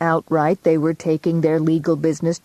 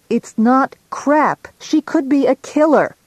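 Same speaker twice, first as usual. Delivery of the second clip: shouting; 9 semitones higher, 5 dB louder than usual. A narrator's reading. Shouting at the detective who dismissed her. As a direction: shout it back down the phone. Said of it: "I shouted".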